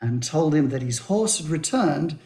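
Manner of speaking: normal talking voice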